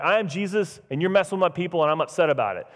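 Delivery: light, run-on mock complaint; mock upset. What Jesus is not saying to Saul, mock upset. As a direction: play a mildly miffed grievance without solemnity. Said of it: "He's not saying, like".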